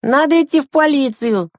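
put-on high child voice making a proposal; high-pitched, earnest kid; young boy Andreyka, earnest. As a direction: pipe up urgently like a small boy.

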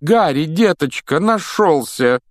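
high, loud, stretched relief; relieved cry. Acted. Mrs Weasley finds Harry, loud relieved cry.

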